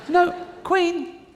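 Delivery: high-pitched call to stop her; mock protest. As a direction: protest to the departing Queen, pitched right up. Pleading to stop the departing Queen.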